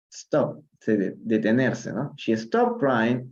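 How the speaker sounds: normal talking voice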